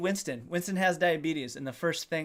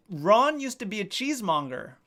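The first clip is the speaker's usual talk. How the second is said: usual speaking voice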